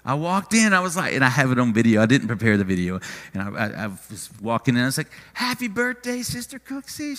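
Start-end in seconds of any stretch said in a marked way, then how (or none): 5.3-7.2 high, soft singsong greeting; retold birthday greeting. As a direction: greet her in a high, sweet pitch. Retells his birthday greeting walking in.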